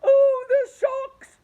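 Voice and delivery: very high, very loud slow shriek; panicked earthworm shrieking sharks; terrified cry. A narrator's reading. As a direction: scream out the fear. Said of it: "cried the Earthworm".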